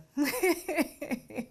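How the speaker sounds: high laugh that falls away; short laugh. Real, not acted. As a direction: let a quick laugh spill out. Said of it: "I can laugh now".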